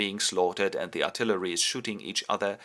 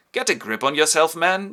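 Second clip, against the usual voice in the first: loud, high-pitched scolding; sharp rebuke; 7 semitones higher, 8 dB louder than usual. Retold or imitated. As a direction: tell him off firmly. Duchess rebukes Homburg.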